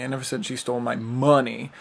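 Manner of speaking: "money" stressed at the end. Shown as a demonstration. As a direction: save the weight for "money". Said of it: "seven different ways".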